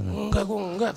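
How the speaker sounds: imitated evasive denial. Friend confronted about summoning a ghost, imitated dodging denial. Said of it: "dia kayak ngeles-ngeles gitu".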